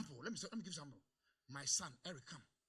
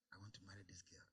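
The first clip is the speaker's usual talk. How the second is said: much lower, soft, timid request; meek son; 11 semitones lower, 16 dB quieter than usual. Retold or imitated. Son asking his father to marry, soft and timid. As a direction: murmur it shyly. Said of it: "He said".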